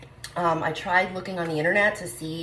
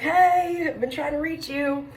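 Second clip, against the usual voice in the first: excited greeting; 12 semitones higher, 5 dB louder than usual. Retold or imitated. Calling back the manager at last.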